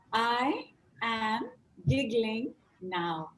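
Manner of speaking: "I am giggling now", slow and drawn out; slow demo. Shown as a demonstration. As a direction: lay it out slowly. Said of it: "And now you're gonna say".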